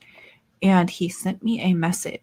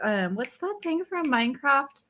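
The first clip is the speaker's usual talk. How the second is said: ordinary delivery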